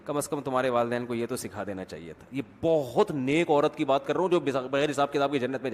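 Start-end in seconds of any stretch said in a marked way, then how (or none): 0.0-2.3 low, softened mother-in-law's dig; gently voiced pious reproach, lower and softer; calm polite reproach. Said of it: "اور زیادہ نیک ہوگی تو کہے گی". (نرم لہجے میں دبا ہوا طعنہ)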